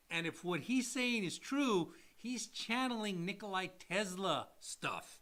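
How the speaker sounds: usual speaking voice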